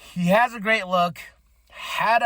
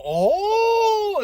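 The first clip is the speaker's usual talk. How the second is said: high, drawn-out mock shocked "ooh"; loud rising gossip gasp; scandalized "oh"; 5 dB louder than usual. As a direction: stretch it out like a crowd reacting to a burn. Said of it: "thing that made me go".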